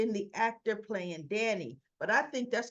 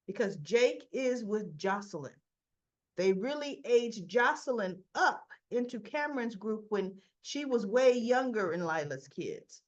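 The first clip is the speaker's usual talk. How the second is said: normal talking voice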